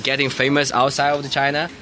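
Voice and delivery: ordinary talk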